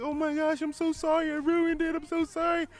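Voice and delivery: very high-pitched imitation of a weeping waitress; mock crying. Imitating waitress who ruined the proposal, mock crying. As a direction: wail an apology in a squeaky put-on voice. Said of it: "She, like, starts crying".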